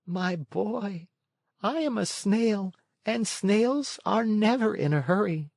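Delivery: a creature who will not rush; unhurried snail. A narrator's reading. Snail replying.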